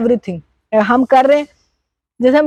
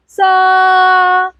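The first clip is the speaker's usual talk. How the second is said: high sa; 6 semitones higher than usual. Shown as a demonstration. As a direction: lift "सा" to the upper note. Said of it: "both are different".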